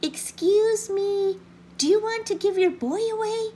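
calling out. A narrator's reading. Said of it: "He called out to the collie".

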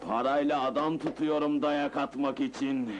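low, unhurried satisfaction; calm, relieved. Acted. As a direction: answer at ease, pitched well down. Boss calm after the beating.

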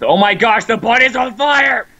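high, loud mock-panic outburst; panicked yell. Player warning friend of a fire, panicked yell. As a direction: yell the alarm, pitch climbing.

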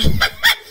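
sudden high squeal of a laugh; startled giggle; high burst of laughter. Real, not acted. Surprised at a game sight.

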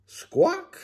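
very high squawk. A narrator's reading. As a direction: squeak out a chicken squawk. Chicken noise in story.

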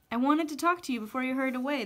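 ordinary delivery, nothing marked

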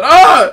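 loud high yelp; scream. Pretend. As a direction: cry out in pain. Patient crying out.

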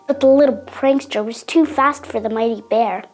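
usual speaking voice

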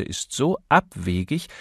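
usual speaking voice

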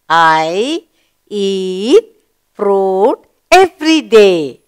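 slow, even reading pace; slow read-aloud. Shown as a demonstration. Teacher modelling the sentence for children, slow and clear. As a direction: read it word by word for the class. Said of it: "Now let's read the sentence".

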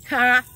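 sharp burst of laughter; one big laugh, loud. Real, not acted. Laugh after vow.

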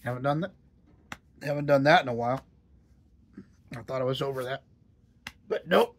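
talking through the hiccups. Real, not acted. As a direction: speak while hiccuping. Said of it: "Give myself the hiccups again".

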